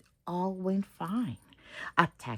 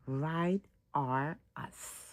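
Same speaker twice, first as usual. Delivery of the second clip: drawn out slogan; 3 semitones lower, 5 dB quieter, slower than usual. Acted.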